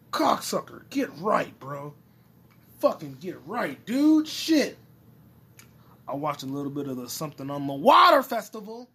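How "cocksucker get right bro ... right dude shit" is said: frustrated outburst. Real, not acted. Angry outburst at phone.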